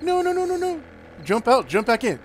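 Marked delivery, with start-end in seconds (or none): 0.0-0.8 high mock-panicked string of no's; high-pitched imitation of frantic protest; imitated panic. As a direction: mimic her squealed no-no-no. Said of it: "She's like".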